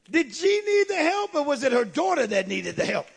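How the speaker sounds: high, loud rhetorical question; emphatic preaching. Real, not acted. Preacher questioning congregation on mother, emphatic. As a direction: throw the question out high, then settle.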